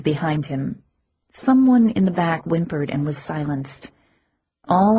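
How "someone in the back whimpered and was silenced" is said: ordinary delivery, nothing marked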